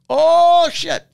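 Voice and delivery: sudden high, loud "Oh" then a dropped "shit"; startled by the news the suspect is returning; alarmed oh shit. Retold or imitated. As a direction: caught off guard, then a curse under the breath. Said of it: "So we're like".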